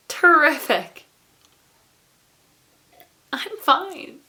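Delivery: strained mock cheer; fake cheerful. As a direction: chirp it like a brave face over misery. Fake-cheerful answer to how are you.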